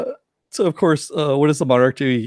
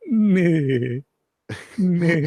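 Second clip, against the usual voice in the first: mock weak evil laugh; 5 semitones higher than usual. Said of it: "Augustus Saint Cloud's like".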